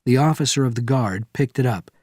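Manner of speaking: plain reading voice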